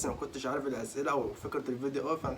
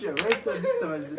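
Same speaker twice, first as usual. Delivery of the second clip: high laughing protest; laughing scold; 13 semitones higher, 5 dB louder than usual. Real, not acted. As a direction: scold her while cracking up.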